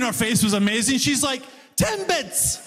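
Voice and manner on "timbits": high, incredulous one-word reply; incredulous customer. Retold or imitated. Customer at the counter repeats it in disbelief. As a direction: say it as if it's obvious.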